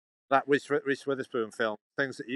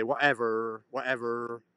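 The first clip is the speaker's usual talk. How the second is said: American whatever; slower than usual. Retold or imitated. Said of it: "that's an Americanism when you say".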